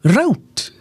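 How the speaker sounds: a mock North American rowt; American route. Shown as a demonstration. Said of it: "Which rhymes with sprout".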